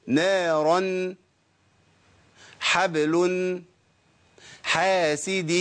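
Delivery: "نارا، حبل، حاسد" each drawn out slowly, ending in a clear n; tanween sounded as noon. Shown as a demonstration. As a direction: hold a ringing n at the end of "نارا", "حبل" and "حاسد". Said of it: "بينطق نون برضو". (نطق تنوين "نارا، حبل، حاسد" نوناً ساكنة ببطء)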